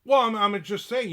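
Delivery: loud, high mock protest; imitated salesman. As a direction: mimic a pushy caller justifying himself. Voicing the persistent salesman.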